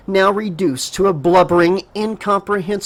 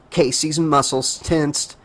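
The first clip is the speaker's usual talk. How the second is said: plain reading voice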